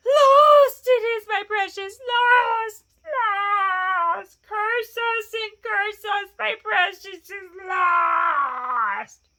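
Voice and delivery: high, loud, drawn-out wail; anguished creature screech; Gollum wailing. A narrator's reading. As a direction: wail and curse in despair, stretching the vowels. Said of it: "Gollum was cursing and wailing".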